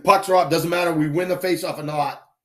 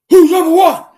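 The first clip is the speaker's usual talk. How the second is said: much higher and louder rally cry; hype yell; 14 semitones higher, 12 dB louder than usual. Retold or imitated. Coach yells to his team before they hit the ice, hype shout. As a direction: pump the kids up at the tunnel door. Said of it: "I'd yell".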